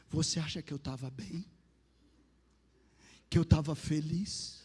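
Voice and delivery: low, measured questioning; lightly voiced God, asking with grave pauses; divine voice. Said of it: "aquela voz falou assim pra mim". (voz divina, grave e pausada)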